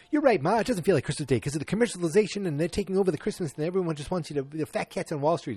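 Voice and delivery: high, rushed son-in-law impression; Michael Stivick. Host as son-in-law agreeing about commercialization, worked-up impression. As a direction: launch into an excitable mock rant. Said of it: "Michael Stivick's like".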